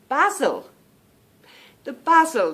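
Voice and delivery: basil said with a short A, "bazzle"; British basil. Shown as a demonstration. Telling her friend about the British basil, "bazzle". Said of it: "I told him about the".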